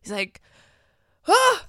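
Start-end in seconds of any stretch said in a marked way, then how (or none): 1.3-1.7 high, loud startled yelp; mock scream. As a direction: shriek at the sight of him. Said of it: "screams at him".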